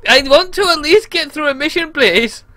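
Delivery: high-pitched, sustained plea; pleading. Real, not acted. Gamer begging to get through a mission, high plea. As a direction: beg it in a high voice.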